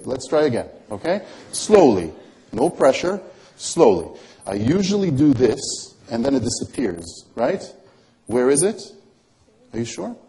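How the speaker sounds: normal talking voice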